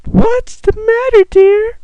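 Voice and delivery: very high, slow falsetto mom; squeaky, drawn-out mock motherly voice; doting mom. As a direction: coo it like a cartoon mother. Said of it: "then she talks to me".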